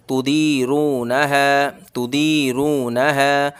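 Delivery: slow तू दी रू ना है with दी and रू drawn long; slow recitation; stretched दी, रू, हा. Shown as a demonstration. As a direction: lengthen दी, रू and हा only. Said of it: "सिर्फ इसमें दाल खींचेगा, रॉ खींचेगा और हा खींचेगा".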